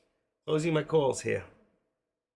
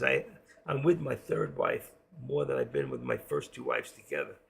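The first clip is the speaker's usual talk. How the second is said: ordinary talk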